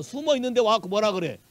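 ordinary delivery

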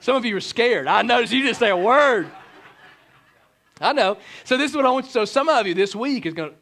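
normal talking voice

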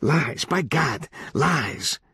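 high, slow, vehement denial; indignant denial. Acted. Kid rejecting the judge's claim, indignant denial. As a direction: swear it is all false, drawn out.

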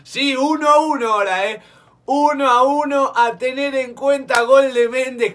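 loud, high, stretched goal call; breakneck goal commentary. Real, not acted. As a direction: scream the goal with joy. Goal for Panamá called. (grito de gol eufórico y alargado)

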